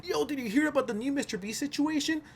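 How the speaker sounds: friend voice. Retold or imitated. Voicing a friend's casual question.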